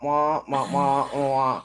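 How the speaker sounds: low trailing wah-wah; muffled wah wah talk. Retold or imitated. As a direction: Peanuts teacher voice, sinking. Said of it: "This is a Peanuts cartoon".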